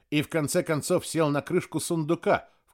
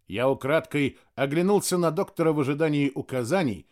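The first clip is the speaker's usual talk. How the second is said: ordinary delivery, nothing marked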